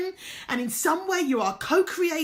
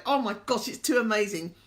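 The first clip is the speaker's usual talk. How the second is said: ordinary talk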